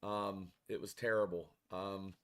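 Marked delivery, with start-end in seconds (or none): none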